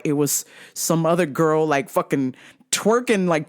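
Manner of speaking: usual speaking voice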